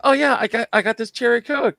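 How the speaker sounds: high-pitched, playful quote; mock soda drinker. Co-host joking about sugary cherry Coke, mock delighted.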